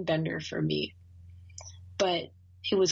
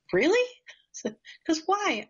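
surprised question; 11 semitones higher than usual. Retold or imitated. Retold disbelieving question.

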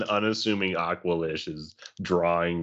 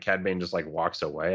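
ordinary talk, nothing marked

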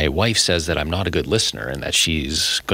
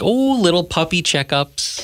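higher, slow, sugary tone; cutesy dreamy voice; 10 semitones higher, slower than usual. Retold or imitated. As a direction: gush about puppies. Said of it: "not all just like".

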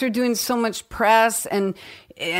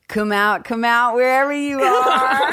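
singsong villain taunt climbing higher; De Niro impression; 5 semitones higher than usual. Actress imitates the Cape Fear line for the host, singsong De Niro impression. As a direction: draw it out like a menacing catcall. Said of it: "I love De Niro impressions".

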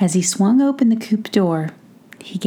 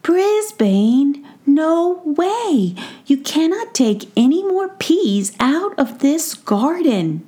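gnome Tom arguing; 8 semitones higher, slower than usual. A narrator's reading. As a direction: scold the mouse for taking peas. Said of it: "Mookie could hear Tom say".

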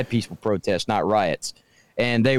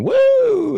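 loud, high drawn-out "woo"; Ric Flair whoop; 5 dB louder than usual. Retold or imitated. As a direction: whoop it out high, held long. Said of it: "he just kept going".

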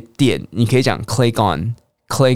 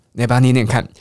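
normal talking voice

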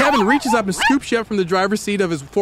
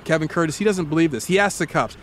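usual speaking voice

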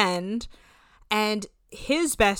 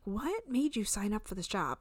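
hushed disbelieving whisper; 11 dB quieter than usual. Retold or imitated. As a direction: breathe it out in a whisper. Unvoiced whispered retold aside.

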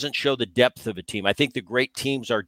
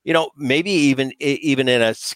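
ordinary delivery

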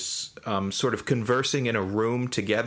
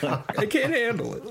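laughing; 12 semitones higher than usual. Acted. Joking about staring into the sun.

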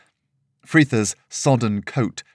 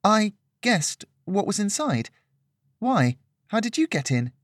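put-on higher, girlish puzzled voice; a young girl turning the question back; confused young girl; 11 semitones higher than usual. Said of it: "Lillian was confused".

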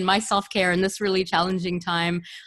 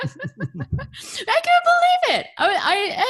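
laughing in disbelief; much slower than usual. Real, not acted. Host laughs in disbelief at story.

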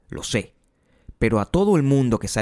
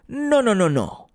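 sneering panto villain; gloating like a supervillain; supervillana malvada; 12 semitones higher, slower than usual. Pretend. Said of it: "como una supervillana que revela su plan maestro". (villana burlona de pantomima)

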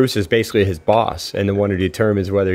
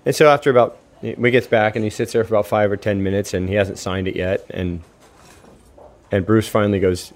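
ordinary delivery

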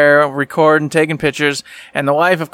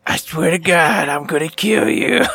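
mock threat. Husband retells wife seeing her photos, mock threat. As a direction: imitate an exasperated wife's threat, half laughing.

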